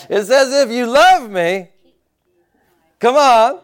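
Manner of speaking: high, loud preacher's call; punchy, emphatic pulpit exhortation; full-force preaching. Acted. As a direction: drive each phrase home to the congregation.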